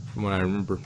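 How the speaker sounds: ordinary delivery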